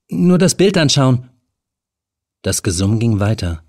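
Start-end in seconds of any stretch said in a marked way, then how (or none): 0.1-1.3 lightly raised nervous woman's voice; a young woman caught trespassing, higher; Helen, nervous. A narrator's reading. Helen caught in the flat, talking to the stranger, higher and nervous.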